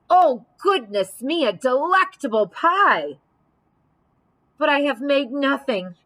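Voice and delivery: high, loud delighted gasp; delighted exclaiming. Acted. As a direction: gush over the pie. Bear exclaims over pie.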